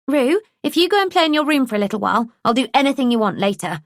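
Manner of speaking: a touch of a high, quick coaxing voice; coaxing a child. A narrator's reading. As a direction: bargain sweetly with a small boy. Talking to a small boy.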